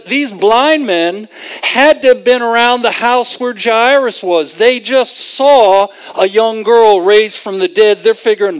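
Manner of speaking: ordinary delivery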